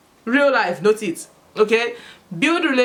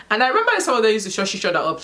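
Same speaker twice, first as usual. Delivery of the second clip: ordinary talk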